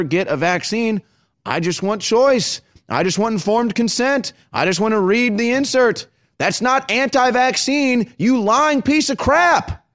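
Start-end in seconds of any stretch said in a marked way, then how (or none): 1.4-9.8 anger rising, barely held in check; holding back a shout. Real, not acted. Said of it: "I'm trying not to yell".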